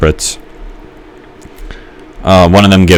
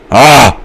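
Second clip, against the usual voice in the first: sudden high yelp of alarm; startled yelp; 7 semitones higher, 5 dB louder than usual. Real, not acted. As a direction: cry out, caught off guard. Caught by guard in game.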